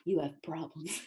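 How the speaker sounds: low, hushed authority figure, imitated; grave program head. Program head calling a student into her office, grave and hushed. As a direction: deliver the verdict quietly, as an imitation.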